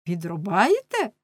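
ordinary delivery, nothing marked

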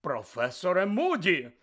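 loud, stunned call; shocked. Acted. McGonagall seeing Moody's lesson, shocked call. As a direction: cry out in disbelief. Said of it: "пролунав приголомшений голос".

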